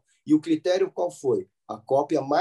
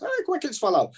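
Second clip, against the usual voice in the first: much higher-pitched mock question; imagined questioner; 11 semitones higher, much faster than usual. Teacher voicing a student's question about vowelless Hebrew, high-pitched puzzled questioner.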